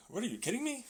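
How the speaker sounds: normal talking voice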